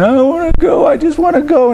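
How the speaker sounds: put-on high, whiny kid voice; whining child. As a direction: imitate a child whining to get their way. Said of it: "whining and complaining".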